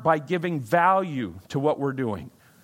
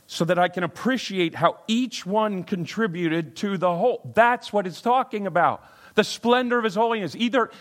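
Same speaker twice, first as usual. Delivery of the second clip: rising, louder preaching build; 7 semitones higher, 4 dB louder than usual. Acted. Pastor preaching to the congregation with rising force.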